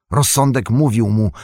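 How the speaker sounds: ordinary delivery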